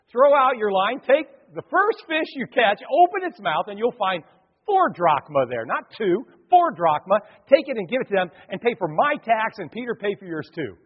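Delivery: high, loud, driving pulpit voice; preaching at full force. Acted. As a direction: preach it out to the congregation. Preaches Jesus' instructions.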